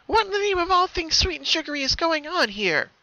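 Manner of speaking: high, bewildered pony voice; baffled by her own hoof; high confused cartoon pony; confused Pinkie.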